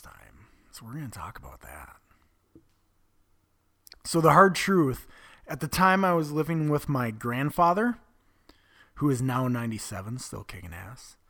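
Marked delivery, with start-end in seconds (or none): 4.0-7.1 hard truth called out loud and punchy; forceful declaration. Real, not acted. Opening his story to the room, loud and emphatic. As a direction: land the word hard like a blow. Restated loudly to start the story.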